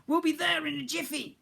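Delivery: high reassuring voice; cheery cook. Acted. Cook reassures the boy, high cheery voice. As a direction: promise it brightly.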